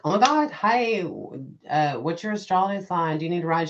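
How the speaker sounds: high, loud and eager; gushing excitement. Retold or imitated. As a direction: rush at her with overexcited friendliness. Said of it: "I was like".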